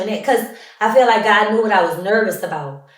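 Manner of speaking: normal talking voice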